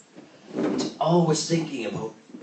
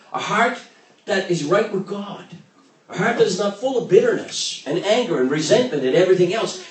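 fervent preaching; 6 semitones higher, 6 dB louder than usual. Acted. Preaching at full force.

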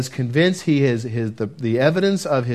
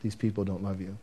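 very soft and low, tender; almost a whisper, gentle and sure; the Lord speaking gently to a scared teenager; 6 semitones lower, 13 dB quieter, faster than usual. Retold or imitated. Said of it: "just gently touch and said".

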